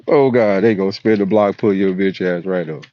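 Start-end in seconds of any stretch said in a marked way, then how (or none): none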